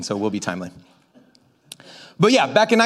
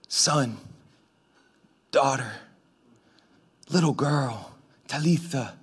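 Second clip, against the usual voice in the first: tender; 6 semitones lower, 8 dB quieter, slower than usual. Acted. Tender translation of Talitha.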